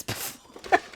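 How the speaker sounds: normal talking voice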